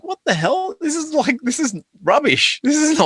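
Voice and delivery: high, squeaky what the hell; mock outrage. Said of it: "I'm like".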